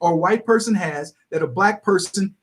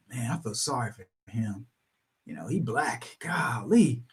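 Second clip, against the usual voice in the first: low, much softer pitying voice; mock pity; 10 semitones lower, 12 dB quieter than usual. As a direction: sigh out a hushed fake sympathy. Mocked pitying child.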